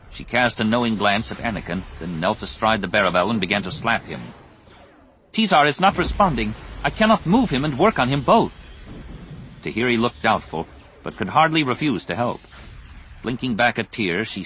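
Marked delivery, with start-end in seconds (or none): 5.3-8.6 higher-pitched, pressing character voice; small medic's insistent high voice; urgent alien medic. Acted. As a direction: report the problem urgently, pitched up. Voices Tekli reporting in battle.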